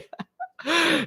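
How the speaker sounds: high-pitched, real burst of laughter; delighted laugh. Real, not acted. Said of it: "I'm laughing 'cause I love it".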